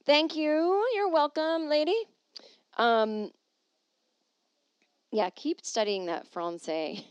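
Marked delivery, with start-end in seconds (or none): 0.1-2.1 sarcastic. Pretend. Sarcastic exchange.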